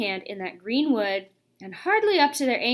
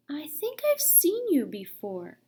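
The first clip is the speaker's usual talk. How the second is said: half-asleep contented murmur; dreamy whisper; 5 semitones higher, slower than usual. A narrator's reading. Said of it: "spoke in a dreamy, contented sort of voice".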